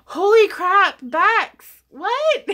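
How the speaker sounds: high, loud, delighted outcry; excited shout. Real, not acted. Streamer shouts to Bex over a big donation, high excited yell. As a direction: shout out in shocked joy.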